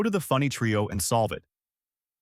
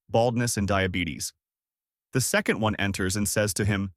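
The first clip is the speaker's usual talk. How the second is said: ordinary delivery, nothing marked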